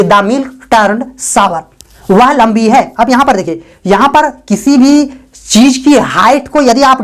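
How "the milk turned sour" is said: very slow, word by word; slow, clear dictation; slow model sentence. Shown as a demonstration. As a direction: spell it out slowly for learners. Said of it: "इस तरह बोलना होता है कि".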